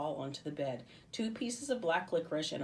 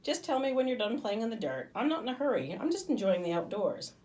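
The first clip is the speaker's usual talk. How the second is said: easygoing higher voice for Bob; unhurried, teasing creature friend; Bob, relaxed; 7 semitones higher, 5 dB louder than usual. A narrator's reading. Bob lounging, teasing Livy about dirt, easy and higher. Bob's easygoing lines in story.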